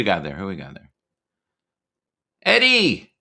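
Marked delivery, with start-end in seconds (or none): 2.4-3.1 high, thrilled call of a name; excited shout. Real, not acted. Greeting a friend who joined, excited. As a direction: greet him with a delighted cry.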